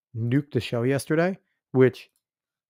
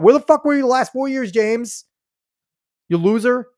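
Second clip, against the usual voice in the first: loud, high, contemptuous yell; angry shout; 9 semitones higher, 5 dB louder than usual. Real, not acted. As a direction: yell it at him in scorn. Angry rant at director.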